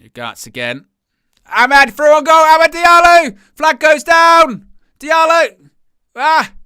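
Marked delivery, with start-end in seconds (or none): 1.5-6.5 roaring high and loud as the striker breaks through; stretched, soaring commentary scream; excited shout. Real, not acted. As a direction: yell it like a goal is coming. Chance on goal, commentator erupts.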